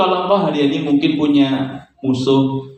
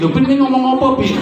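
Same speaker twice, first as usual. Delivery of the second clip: high, startled cry of alarm; a mother caught off guard by her son's words; a mom shocked at her boy's prayer, much higher; 13 semitones higher, 3 dB louder, faster than usual. Retold or imitated. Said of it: "aku kaget".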